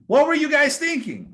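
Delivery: high, loud acted outburst as Moses; indignant, incredulous shout; exasperated confrontation. Voices Moses confronting the people.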